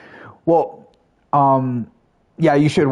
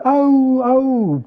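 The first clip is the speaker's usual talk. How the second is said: animals howling; 12 semitones higher, 3 dB louder than usual. Retold or imitated. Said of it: "the cats and dogs in the neighborhood are like".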